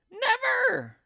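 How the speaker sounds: put-on a shrill old woman's shriek; crying out. A narrator's reading. Wife crying out in denial, high and loud. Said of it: "she cried".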